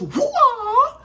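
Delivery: sudden high yelp of amazement; startled exclaim; surprised whoa. Real, not acted. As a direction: gasp it out, way up high. Sudden kick in recap.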